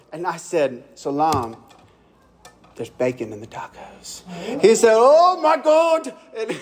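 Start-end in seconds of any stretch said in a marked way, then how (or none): 0.9-4.2 much lower and much softer, delivered like a secret; hushed reveal. Retold or imitated. Pastor breaking bad news to Salam, low hushed reveal. Punchline revealing bacon in tacos. 4.9-6.1 higher and slow, laughing through it; mock shocked. Salam hearing about the bacon, laughing mock shock.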